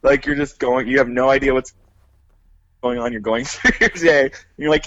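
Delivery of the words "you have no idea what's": usual speaking voice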